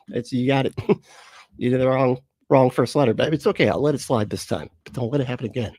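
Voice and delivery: ordinary talk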